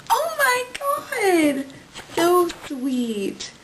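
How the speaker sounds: high, slow gushing squeal; delighted high squeal. Real, not acted. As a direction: squeal over how sweet it is. Reacting to mom's card.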